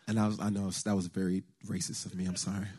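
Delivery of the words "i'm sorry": ordinary talk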